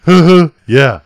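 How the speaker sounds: punchy imitated "huh, huh"; imitating friend's chuckle. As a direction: imitate your buddy's knowing laugh, loudly. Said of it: "He goes".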